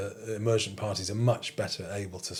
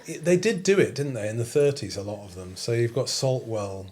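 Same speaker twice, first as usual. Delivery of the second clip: normal talking voice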